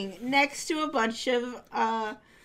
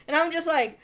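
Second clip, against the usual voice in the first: ordinary talk, nothing marked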